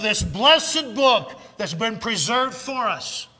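loud, high, full-force pulpit preaching; fiery preaching. Acted. Preacher rousing his congregation, shouting at full force. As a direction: thunder it out to the congregation, building to a roar.

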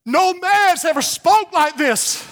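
high, slow preacher's cry; ringing pulpit declaration. Real, not acted. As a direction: proclaim it high, stretching the words. Same line repeated at full force.

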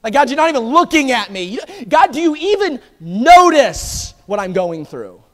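loud, high plea to God; pleading outcry. Retold or imitated. David pleading with a hidden God, loud outcry. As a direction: press God with rising desperation. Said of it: "He goes on, he says".

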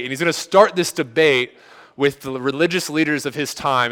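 ordinary talk

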